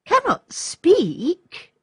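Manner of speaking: high, slow, incredulous question; astonished. A narrator's reading. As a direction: stretch it out in disbelief. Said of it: "interrupted Olga, astonished".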